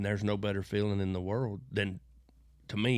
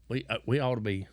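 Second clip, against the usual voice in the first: ordinary delivery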